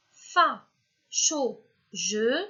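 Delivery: FA, CHAUD, JE as three detached syllables; syllable-order test. Shown as a demonstration. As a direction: try FA, CHAUD, JE with pauses between. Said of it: "première syllabe". (« fa, chaud, je », syllabes détachées une à une)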